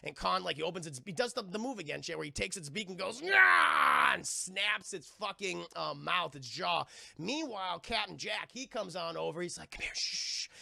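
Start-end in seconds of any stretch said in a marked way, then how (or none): 3.2-4.1 loud, high, straining yell; imitated monster roar. Kong wrenches the pterodactyl's beak, loud acted roar. 9.7-10.5 acted secretive whisper; hushed whisper. Captain Jack sneaks over to Fay Wray, mock hushed whisper.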